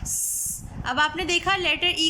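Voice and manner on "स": unvoiced स, only the hiss; soft hissed स, no vowel; sound of S. Shown as a demonstration. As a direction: breathe out a sharp स hiss. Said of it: "एस का sound होता है".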